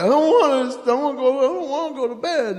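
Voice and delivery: put-on high whining child refusing bed; whiny kid. Son whining to dad about bedtime. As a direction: whine it like a stubborn little boy.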